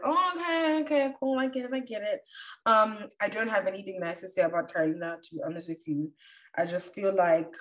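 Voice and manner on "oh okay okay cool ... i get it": mock realization. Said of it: "Then it would really be like".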